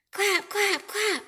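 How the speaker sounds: high loud clap clap; clapping sound. Acted.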